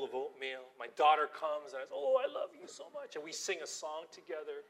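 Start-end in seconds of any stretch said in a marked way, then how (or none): 1.9-3.1 higher, loving coo; tender. Retold or imitated. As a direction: greet the little daughter sweetly. Loving greeting to daughter.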